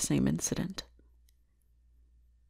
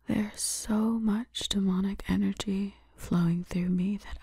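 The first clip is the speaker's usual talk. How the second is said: seductive whisper. Acted. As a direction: whisper to him as if drawing him close. Seductive whispers to her captive.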